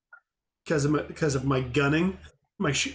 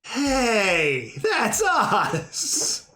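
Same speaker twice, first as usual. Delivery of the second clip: excited; 5 semitones higher, much slower than usual. Real, not acted. Players recognise themselves as fools.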